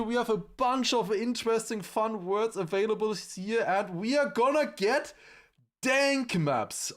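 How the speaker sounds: high, upbeat hyped announcing; YouTuber intro voice. Acted. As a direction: sell the video with energy. Fourth take of stream intro.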